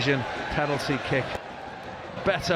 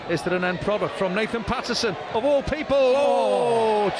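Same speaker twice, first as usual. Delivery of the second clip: excited commentary, building; 6 semitones higher than usual. Real, not acted. Commentator as Patterson shoots, excitement building to a high cry. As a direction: build up to the shot, pitch climbing into a groan. Chance builds, then goes wide.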